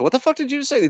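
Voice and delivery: high-pitched incredulous challenge, re-enacted; indignant disbelief. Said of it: "That's exactly how I said it to him".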